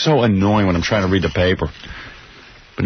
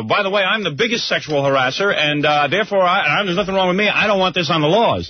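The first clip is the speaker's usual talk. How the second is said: mock harasser voice; 7 semitones higher than usual. Voicing hypothetical harasser.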